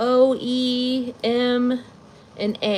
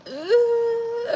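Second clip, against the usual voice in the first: whimper; 12 semitones higher than usual. Real, not acted. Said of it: "I'm gonna start crying".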